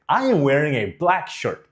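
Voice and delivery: bright-eyed excitement through a giant grin; high energy. Shown as a demonstration. Coach speaks through a giant grin, high energy. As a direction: grin wide and let the words come out lively. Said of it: "words just came out with high energy".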